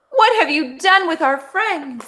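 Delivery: high, frightened demand; alarmed. Acted. Dog confronting Wolf about friends, high and alarmed. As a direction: plead in panic for the friends.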